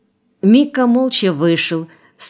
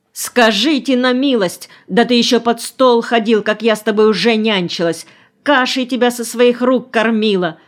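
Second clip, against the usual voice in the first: offended cry; 5 semitones higher than usual. A narrator's reading. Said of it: "обиженно закричала эта добрая душа".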